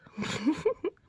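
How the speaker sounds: high relieved laugh. Real, not acted. Relieved laugh after near mishap.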